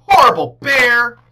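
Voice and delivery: high loud furious shout; angry yell. A narrator's reading. Girl stomping through the meadow, yelling angrily.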